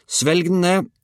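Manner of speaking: high, urgent command; called-out order. A narrator's reading. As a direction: call the order out at high pitch. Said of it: "ropte Georg".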